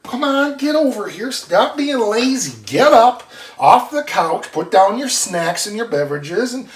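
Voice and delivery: loud, high mock scolding of lazy viewers; playful call. Calling couch-bound viewers over, loud teasing. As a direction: holler them off the couch, teasing.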